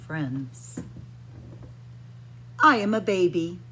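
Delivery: usual speaking voice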